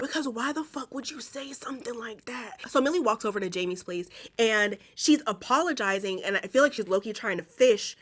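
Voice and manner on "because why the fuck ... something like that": half-whispered, softer, incredulous question; hushed disbelief. Real, not acted. Reacting to Millie's uncalled-for shade, hushed disbelief. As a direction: drop into an appalled whisper. Appalled aside at a cruel remark.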